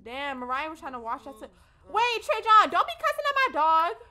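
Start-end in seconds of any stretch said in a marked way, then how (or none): 1.9-4.0 loud high call-out at Trey John; shouting at a game character. Real, not acted. Streamer yelling at game character over her dog, loud. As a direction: yell across the room in protest.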